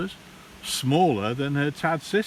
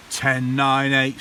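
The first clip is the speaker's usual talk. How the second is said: plain reading voice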